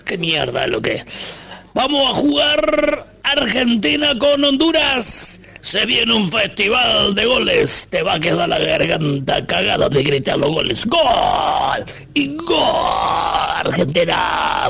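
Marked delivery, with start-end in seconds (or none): 1.7-7.9 high, slow, stretched-out proclamation; hyped match announcement. Acted. [name] announcing Argentina against Honduras, hammed-up excitement. 10.9-14.7 long, drawn-out goal cries; mock goal celebration. [name] imagining a festival of goals, mock celebration.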